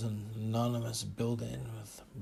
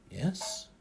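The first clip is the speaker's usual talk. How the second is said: higher, puzzled question; bewildered; 4 semitones higher than usual. A narrator's reading. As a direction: answer as if baffled.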